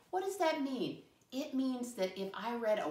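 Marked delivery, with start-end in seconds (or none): 0.1-1.0 much higher voice, imitating a puzzled pupil; high mock-student query; voicing pupils' question. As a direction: pipe up high as the class asking. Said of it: "That's what you're asking".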